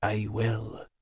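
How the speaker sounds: low and hushed; quiet vow. Acted. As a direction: answer softly, humbly. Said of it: "He said".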